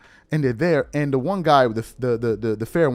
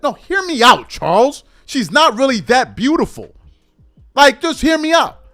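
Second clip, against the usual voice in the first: mock pleading; 7 semitones higher, 5 dB louder than usual. Mocking the guy's pleading pitch.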